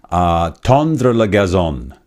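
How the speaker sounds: put-on slow French with a heavy Italian accent, tondre la gazon drawn out. Host recalls comedian's old character, slow Italian-accented French. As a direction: imitate the Saint Leonard French-Italian guy in a slow Italian-accented French. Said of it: "my French-Italian guy".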